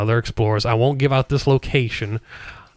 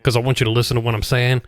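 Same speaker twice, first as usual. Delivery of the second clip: usual speaking voice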